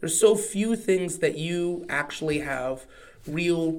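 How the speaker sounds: usual speaking voice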